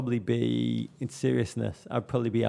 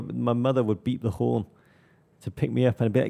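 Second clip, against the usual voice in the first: usual speaking voice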